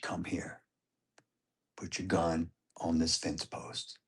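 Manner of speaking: whispered coaching. Retold or imitated. Dad whispering to his son lining up a shot, soft and unhurried. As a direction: breathe the instructions so the hogs can't hear.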